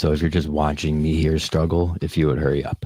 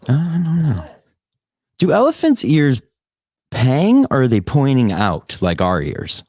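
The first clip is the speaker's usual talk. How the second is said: high, louder mock-puzzled voice; silly high voice; 9 semitones higher, 5 dB louder, slower than usual. Wondering about elephant ears, asks in a silly high voice. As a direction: ask it in a squeaky put-on voice.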